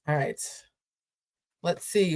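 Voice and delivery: ordinary talk, nothing marked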